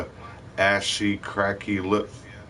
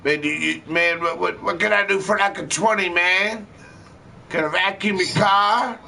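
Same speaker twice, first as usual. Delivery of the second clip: much higher, whiny pleading hustle; begging dope fiend; 11 semitones higher than usual. Retold or imitated. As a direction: beg for twenty bucks, jittery and high.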